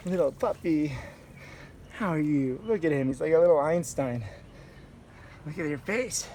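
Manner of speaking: quiet cooing at a little dog. Real, not acted. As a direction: coo softly like to a pet. Cooing to his puppy.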